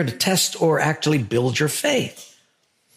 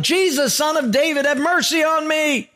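high, pleading cry for mercy; a blind beggar begs Jesus for mercy, a high plea; pleading yell; 11 semitones higher than usual. Retold or imitated. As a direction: call out to Jesus over the crowd. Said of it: "he starts yelling".

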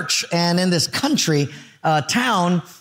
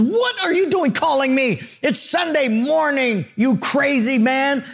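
high-pitched, indignant mock rant; belligerent yelling; 7 semitones higher than usual. Mayor to pastor calling on Sunday morning, mock irate yelling. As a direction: act out an irate mayor telling someone off. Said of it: "he starts yelling at this pastor".

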